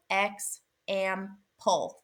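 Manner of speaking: Ex, am, pull as three separate beats; syllable by syllable. Shown as a demonstration. Teacher counting syllables in example, syllable by syllable. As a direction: break example into Ex, am, pull with a pause after each. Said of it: "how many syllables".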